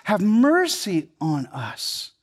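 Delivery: mercy stretched slow and weighty; slow emphasis. Shown as a demonstration. Preacher contrasts pity with mercy, slow stress on mercy. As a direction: lean hard on mercy, slowly.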